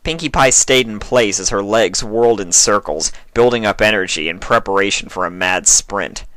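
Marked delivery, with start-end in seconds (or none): none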